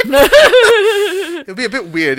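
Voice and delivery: slow, loud, laughing through words. Real, not acted. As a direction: laugh while saying it. Laughing at Welsh Lizard idea.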